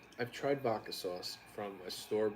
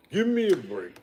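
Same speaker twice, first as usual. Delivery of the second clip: higher, louder give me a break; loud protest; 7 semitones higher, 7 dB louder than usual. Real, not acted. Host protesting his co-host calling him vain, loud and high. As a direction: shout it in mock outrage.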